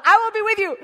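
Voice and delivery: loud, high, insistent declaration; emphatic divine promise. Acted. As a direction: proclaim it forcefully as God. God's insistent repeated refrain.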